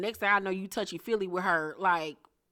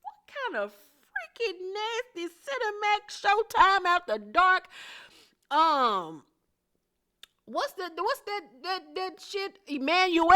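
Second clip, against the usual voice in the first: much higher, slow, incredulous; scandalized high voice; 10 semitones higher, slower than usual. Real, not acted. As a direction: raise the voice way up in shock. Scandalized reaction.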